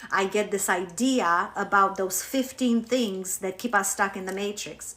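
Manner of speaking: ordinary delivery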